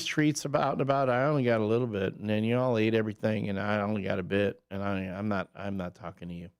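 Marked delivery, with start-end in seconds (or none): 1.1-6.5 low, quick grumble for a miffed guest; sulking Harley. Acted. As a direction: play someone refusing to speak to you. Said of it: "she's like".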